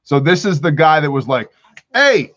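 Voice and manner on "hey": lightly hammed-up Italian mobster calling out; mock mobster. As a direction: ham up a New York wiseguy. Voicing a mobster hunter.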